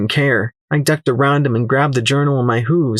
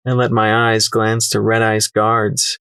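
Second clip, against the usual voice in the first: ordinary delivery, nothing marked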